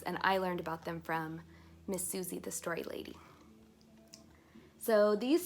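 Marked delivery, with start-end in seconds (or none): none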